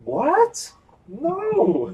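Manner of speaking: very high, loud mock shock; hammed-up imitation of feigned innocence; unconvincing liar. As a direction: squeak out an obviously false denial. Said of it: "It sounded like he was lying".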